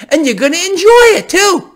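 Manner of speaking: high, loud villain yell; gloating shout. Retold or imitated. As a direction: scream the taunt at them. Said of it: "Fed Dali here".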